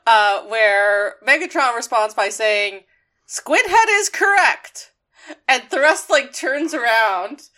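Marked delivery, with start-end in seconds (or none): 3.3-4.9 lightly voiced high, mocking villain; retold taunt, pitched high; taunting villain quote. Podcast host retelling Megatron's insult, high mock taunt.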